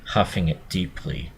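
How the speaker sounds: plain reading voice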